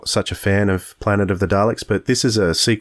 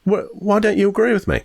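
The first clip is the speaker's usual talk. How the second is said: mock indignant; 13 semitones higher than usual. Co-host mock-offended by disagreement.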